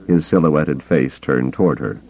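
ordinary delivery

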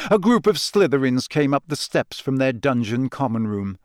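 ordinary delivery

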